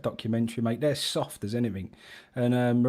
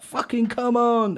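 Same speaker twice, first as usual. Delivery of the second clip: high, slow, drawn-out mock rally cry; Kane team talk impression; 10 semitones higher, 3 dB louder, slower than usual. Kane doing Mourinho's team talk, mock rousing yell. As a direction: ham up a captain's one-line team talk. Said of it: "he's just like".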